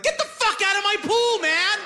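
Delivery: high, yelled anger at a friend in the pool; angry yell. Retold or imitated. Buddy yells at him.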